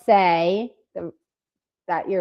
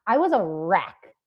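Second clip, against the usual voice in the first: usual speaking voice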